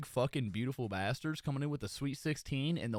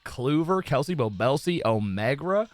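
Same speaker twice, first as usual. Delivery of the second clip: normal talking voice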